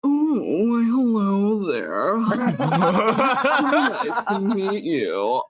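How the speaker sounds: slow, drawn-out over-the-top gentleman; exaggerated man voice. Shown as a demonstration. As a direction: stretch it out like a pompous man. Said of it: "some just really exaggerated man".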